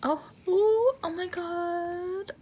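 high, loud squeal of delight; excited squeal. Real, not acted. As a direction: gasp with delight as if the card is a treasure. First sight of the photo card.

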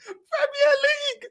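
high squeaky mock whine, voice cracking upward; Saeed's squeaky voice. Mocking the fan's breaking voice, high and squeaky. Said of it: "When his voice goes squeaky".

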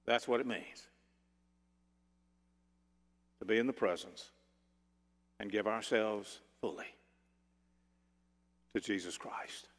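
low, very soft, reverent; hushed sermon close. Real, not acted. Preacher closing the sermon before prayer, hushed and solemn. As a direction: lead them quietly into prayer, pausing between phrases.

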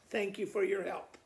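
ordinary delivery

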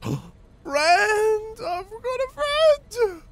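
high, slow, squeaky gleeful voice; squeaky delight. Pretend. Player voicing giddy joy at his new friend, squeaky high coo. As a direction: coo like a thrilled little kid.

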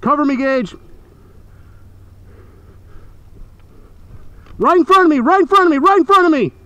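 loud, high urgent callout; cover me shout. Real, not acted. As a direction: bark the enemy position. Calling for cover under fire.

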